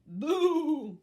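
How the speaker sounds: very loud mock boo; loud goat boo. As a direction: shout boo to scare. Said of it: "said Goat".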